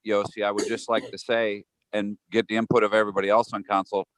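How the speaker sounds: usual speaking voice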